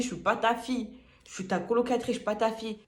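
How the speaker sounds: angry retort. Retold or imitated. Telling the roommate off, loud and insistent. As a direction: insist she is not her daughter. Retelling her angry comeback.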